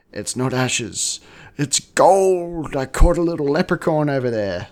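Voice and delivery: mock drunk insisting on his leprechaun; excited drunk husband. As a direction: blurt it out giddy, climbing up.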